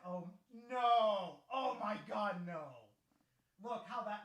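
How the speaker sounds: normal talking voice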